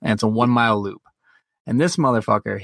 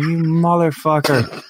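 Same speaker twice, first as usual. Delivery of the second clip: slow, higher-pitched mock outrage; exasperated retold curse; 4 semitones higher, slower than usual. Owner watching dog dump in foyer, retold exasperation. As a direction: draw the curse out in comic disbelief.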